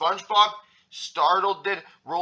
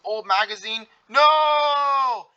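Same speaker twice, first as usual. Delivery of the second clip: high, horrified question into a long scream; 8 semitones higher, 6 dB louder, much slower than usual. A narrator's reading. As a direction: scream it out, stretching the last word. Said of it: "Patrick screamed".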